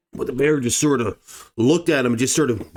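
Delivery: ordinary talk, nothing marked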